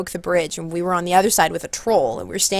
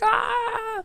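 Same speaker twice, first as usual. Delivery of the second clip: very high, soft drawn-out ah; imitated crowd cry; 6 dB quieter than usual. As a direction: squeal softly like a reacting crowd. Crowd reacts to troll's joke.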